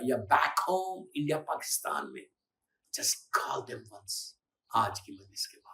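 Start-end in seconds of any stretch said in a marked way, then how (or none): 2.9-5.7 whispered appeal; hushed whisper to the crowd. Real, not acted. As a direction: lean in and whisper it. Intimate appeal.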